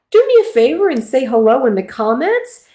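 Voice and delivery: ordinary talk, nothing marked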